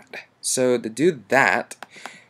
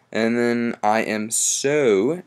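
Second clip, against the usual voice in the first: "so" said slow and drawn out; stress on so; slower than usual. Shown as a demonstration. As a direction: lean on the word "so" to emphasize it. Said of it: "the so is emphasize".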